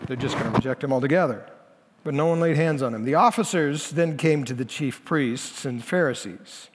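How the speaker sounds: ordinary talk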